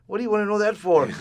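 high, loud imitation of his father; loud, high-pitched retold dad; incredulous dad. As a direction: challenge him as a mock-suspicious dad. Dad challenging his son.

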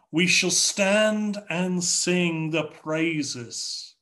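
ordinary talk

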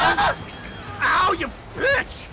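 sudden high yelp, then a shout; pained shout. Real, not acted. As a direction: cry out as you're grabbed. Caught by the killer.

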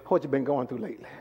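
low, soft, quick aside; quiet question. Real, not acted. Preacher asking about recent troubles, quiet question. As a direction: ask it softly and low.

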